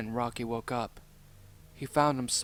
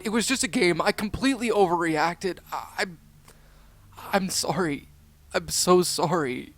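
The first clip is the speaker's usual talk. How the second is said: higher-pitched, stumbling, pleading apology; upset apology; 6 semitones higher, 8 dB louder than usual. Acted. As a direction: stammer out a heartfelt apology, voice raised. Character tearfully apologises to friend.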